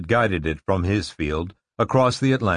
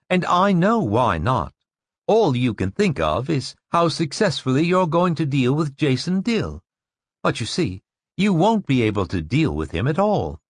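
intense woman voice; 6 semitones higher than usual. Acted. Narrator voices Rachel.

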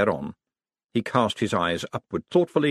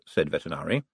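usual speaking voice

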